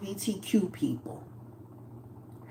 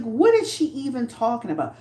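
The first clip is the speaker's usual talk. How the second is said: normal talking voice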